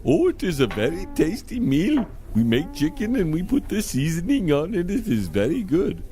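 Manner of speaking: put-on sing-song accented shopkeeper; eager foreign pizza seller. Pizza seller recommending a dish, eager and accented.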